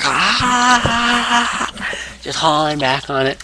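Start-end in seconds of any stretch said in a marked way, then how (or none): 0.0-1.7 high straining grunt; mock strain grunt. Booker voicing Punk cranking the hold, straining grunt. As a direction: haul back with a high grunt. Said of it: "C M Punk is like".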